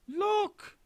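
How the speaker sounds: high shrill shriek; screamed. A narrator's reading. Missus Twit shrieking at the upside-down room. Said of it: "screamed Missus Twit".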